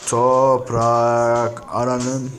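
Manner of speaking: very slow, stretched syllables with long gaps; slow sounding-out. Real, not acted. Streamer saying words while typing, slowly stretched out. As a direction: draw each word out as if typing along.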